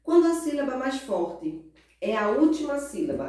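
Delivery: ordinary talk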